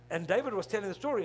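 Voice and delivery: usual speaking voice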